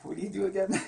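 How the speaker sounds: high giggling; laughing. Real, not acted. Husband teasing his wife, cracking up. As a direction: crack up mid-question.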